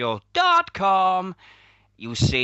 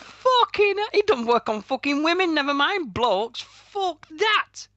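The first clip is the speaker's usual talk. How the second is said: high, strained outrage; indignant rant; 3 dB louder than usual. Real, not acted. Host rejects a wrestler's look to his co-host, high indignant rant.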